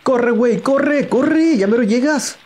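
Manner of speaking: urgent cheering. Acted. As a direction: yell him on to run. Urging Link on, high and loud.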